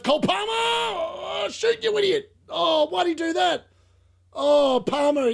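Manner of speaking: high, loud exasperated yelling; real frustration; frustrated shout. Real, not acted. Shouting at the player.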